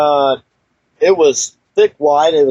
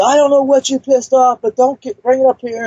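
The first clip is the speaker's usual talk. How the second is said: put-on much higher, shouted at a kid on his lawn; angry old neighbor yelling; 9 semitones higher than usual. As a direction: bark at the trespasser in a high, strained old man's yell. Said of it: "he said".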